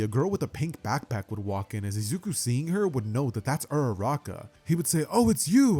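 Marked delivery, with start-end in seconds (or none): 5.1-5.8 high, loud happy cry; delighted greeting. Acted. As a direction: greet her with a burst of joy. Happy recognition of Uraraka.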